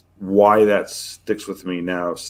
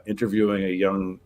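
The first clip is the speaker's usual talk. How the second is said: normal talking voice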